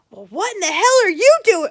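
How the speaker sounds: high, loud indignant demand; mock angry. Brother opening the door to the girl, mock angry.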